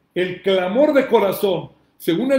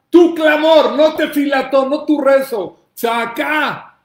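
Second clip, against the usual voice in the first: louder, higher proclamation building to a shout; forceful preaching; 5 semitones higher, 7 dB louder, slower than usual. Acted. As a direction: preach the promise with rising force. Preaching the verse loudly.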